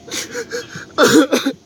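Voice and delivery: a raw, high sob. Real, not acted. Overwhelmed by memories of Scully, a real sob. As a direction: break down into a sob.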